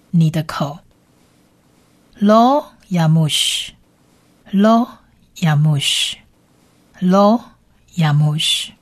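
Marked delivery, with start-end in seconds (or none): 2.2-8.7 "lo yamush" said slowly with a gap after "lo"; measured language-teacher repeats of "lo yamush"; slow pronunciation drill. Shown as a demonstration. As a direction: repeat "lo yamush" word by word for learners. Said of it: "不可离开".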